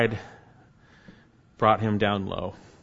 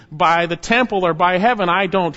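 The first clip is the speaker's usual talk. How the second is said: oath; 5 semitones higher, 6 dB louder than usual. Retold or imitated. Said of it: "He's saying".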